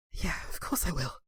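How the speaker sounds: soft broken whisper; defeated whisper. Acted. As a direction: barely get the words out. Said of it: "He looks defeated".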